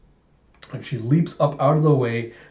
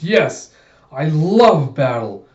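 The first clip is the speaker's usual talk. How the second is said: manic battle lust; 3 semitones higher, 6 dB louder, slower than usual. Acted. Manic battle-crazed android girl.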